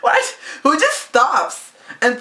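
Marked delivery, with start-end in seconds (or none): 0.0-0.4 high incredulous what, what; mock bafflement. Friend confused by a stranger's line, high and baffled. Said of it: "I was like".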